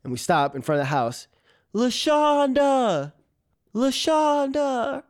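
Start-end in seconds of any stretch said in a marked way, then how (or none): 1.7-5.0 high, slow drawn-out call; long calling-out of a name. Retold or imitated. Calling for the lost cat.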